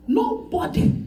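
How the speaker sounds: ordinary talk, nothing marked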